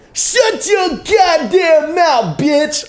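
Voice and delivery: high, slow, drawn-out yell; loud mock-enraged holler; screamed catchphrase. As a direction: imitate the man's scream at full pitch, stretching each word. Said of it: "And we were saying".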